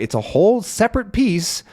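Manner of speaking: ordinary talk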